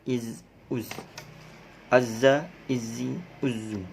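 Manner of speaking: Za, za, Zi, zi with a buzzing z; bee buzz z. Shown as a demonstration. Teacher to class, buzzing zai drill. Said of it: "menyerupai suara lebah".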